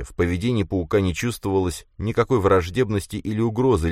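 plain reading voice

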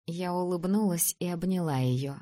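plain reading voice

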